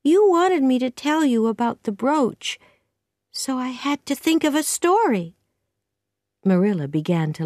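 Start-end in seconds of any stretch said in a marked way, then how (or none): 0.0-5.4 put-on high-pitched girl explaining herself; high, mildly plaintive young voice; explaining young Anne. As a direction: justify it up high as a child.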